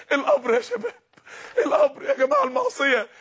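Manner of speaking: high, tearful cry about the grave; crying plea. Real, not acted. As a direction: plead through tears, voice high and breaking. Preacher breaks into crying plea. (بكاء ونداء بصوت عالي مرتجف)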